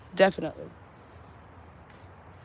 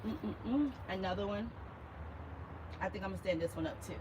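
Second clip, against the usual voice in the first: ordinary talk, nothing marked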